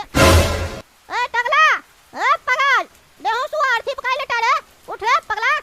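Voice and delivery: loud, drawn-out shout to wake someone; hollering at the bald leader to wake up; calling out loud. Acted. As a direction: yell at him to get up.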